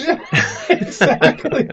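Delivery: laughing. Real, not acted. Laughs at pumpkin predators joke.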